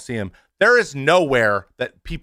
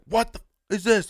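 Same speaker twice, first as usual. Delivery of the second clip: higher, exasperated mock yelp; frustrated outburst; 10 semitones higher than usual. Streamer when something breaks, higher exasperated mock outburst. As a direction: imitate your own snap when something breaks. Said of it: "I'll be like".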